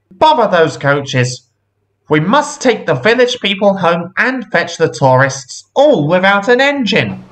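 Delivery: high, fretful complaint; agitated boss in a fix; exasperated controller. A narrator's reading. Controller exasperated, no engine for train.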